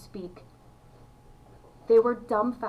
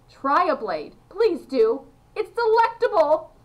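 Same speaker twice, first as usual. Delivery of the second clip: rising into higher, louder enthusiasm; eager urging; 6 semitones higher, 6 dB louder than usual. A narrator's reading. Mister Wonka urging the children to try his sugar grass, eagerly. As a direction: press them excitedly to taste it.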